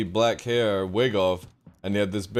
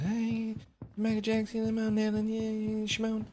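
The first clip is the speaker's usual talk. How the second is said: high, soft falsetto impression; the put-on public voice; 11 semitones higher, 6 dB quieter than usual. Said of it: "He put that voice on".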